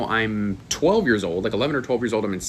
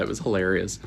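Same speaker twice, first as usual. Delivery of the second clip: ordinary talk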